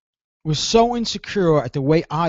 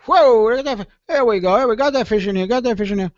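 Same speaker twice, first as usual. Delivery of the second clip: high, eager, reeling it in; hyped whoa-I-got-one fisherman voice; excited angler; 7 semitones higher, faster than usual. Retold or imitated. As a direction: play a thrilled fisherman with a bite on the line. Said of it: "you're fishing and you get".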